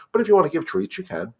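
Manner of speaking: usual speaking voice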